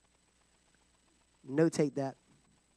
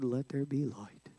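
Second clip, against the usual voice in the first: breathy, lifeless whisper of a dry scribe; mock the scribes with a limp whisper; 10 semitones lower, 12 dB quieter than usual. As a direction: whisper it like there's no power in it. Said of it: "And they talk like this".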